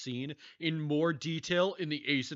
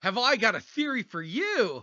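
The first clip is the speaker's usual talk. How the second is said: MatPat impression; 9 semitones higher, 8 dB louder than usual. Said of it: "Hello, Matt Pat".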